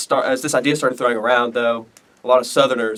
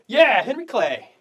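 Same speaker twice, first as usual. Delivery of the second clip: high, drawn-out fan cheer; hyped up; 10 semitones higher, slower than usual. Pretend. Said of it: "Adam Norris is hype, so I gotta get hype".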